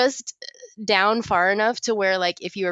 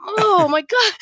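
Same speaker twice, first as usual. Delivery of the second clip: high shocked shout, much higher and louder; shocked outcry; 13 semitones higher, 4 dB louder, slower than usual. Retold or imitated. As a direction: yell it when you see the open zipper. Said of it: "And I'm like".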